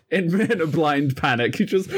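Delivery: words broken up by real laughter; laughing too hard to finish the sentence. Real, not acted. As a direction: talk through the giggles. Player laughing mid-sentence.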